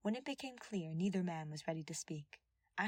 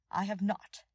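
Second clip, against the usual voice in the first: challenged; 3 semitones higher, 7 dB louder than usual. A narrator's reading. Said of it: "Dudu challenged".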